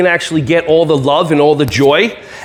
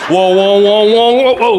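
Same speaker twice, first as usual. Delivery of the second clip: dodging whoa. Pretend. Dodging again.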